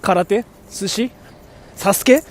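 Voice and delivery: karate, sushi, Sasuke said with Japanese sounds; Japanese pronunciation. Shown as a demonstration. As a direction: say "kah-rah-teh, su-shi, Sas-keh" Japanese-style. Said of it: "especially when I pronounce Japanese words".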